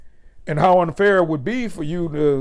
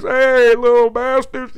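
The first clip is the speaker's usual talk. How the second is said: playful call; 7 semitones higher, 5 dB louder, slower than usual. Pretend. Calling to imagined kids.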